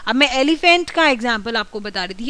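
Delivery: normal talking voice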